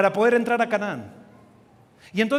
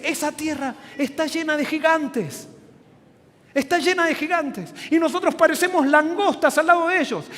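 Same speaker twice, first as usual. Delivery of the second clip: higher, insistent protest of defeat; alarmed report; 5 semitones higher than usual. Acted. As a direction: preach it as a spy warning the crowd. Acted spies' alarmed report to the people. (informe alarmado, con voz aguda e insistente)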